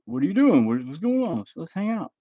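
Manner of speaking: imitating viewers who want to hang out, high and teasing; mocking viewers.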